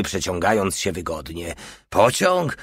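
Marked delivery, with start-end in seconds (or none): 1.9-2.6 high-pitched startled question; incredulous echo. A narrator's reading. Gregor doubting the little one, incredulous. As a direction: throw the word back high, in disbelief. Gregor disbelieves the train guess.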